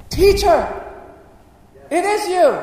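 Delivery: high, loud joyful exclamation; astonished cry. Acted. Mary recognises her risen teacher, astonished high cry. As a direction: cry out in acted amazement.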